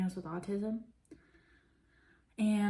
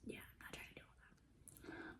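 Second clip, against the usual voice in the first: whispering; 20 dB quieter than usual. Real, not acted. Whispered aside.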